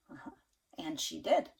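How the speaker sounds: plain reading voice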